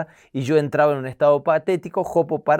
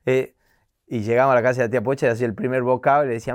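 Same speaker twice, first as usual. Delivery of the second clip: ordinary talk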